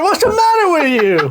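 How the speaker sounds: high, laughing outburst; laughing exclaim. Retold or imitated. Friend quoting reaction, laughing. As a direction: blurt it out in disbelief.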